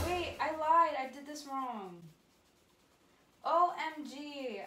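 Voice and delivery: high, loud burst of alarm; dismayed realisation. Real, not acted. Teacher catching their own mistake mid-tutorial, loudly dismayed. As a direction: gasp and blurt out the mistake.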